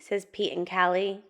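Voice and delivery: usual speaking voice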